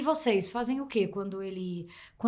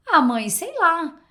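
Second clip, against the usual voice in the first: voicing her son; 6 semitones higher, 7 dB louder, slower than usual. Mother voices her son's reply.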